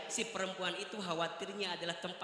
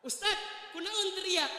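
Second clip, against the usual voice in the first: higher puzzled husband; 10 semitones higher than usual. Retold or imitated. Husband asking why screamed.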